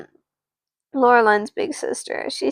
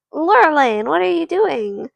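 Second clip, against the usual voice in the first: put-on high, louder kid-brother call; higher-pitched little sibling voice; 7 semitones higher, 5 dB louder than usual. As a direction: pipe up as a pestering little brother. Little brother addressing his sister.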